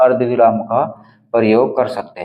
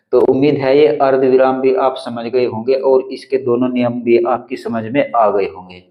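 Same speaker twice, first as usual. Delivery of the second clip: normal talking voice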